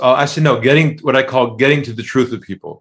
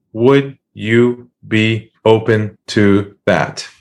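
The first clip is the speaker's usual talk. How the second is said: low and unhurried, stretched out; slow lowered question; 3 semitones lower, much slower than usual. Retold or imitated. Offering the caller a process, slow and calm. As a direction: ask it slowly, almost lazily. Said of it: "lower your voice and slow things down".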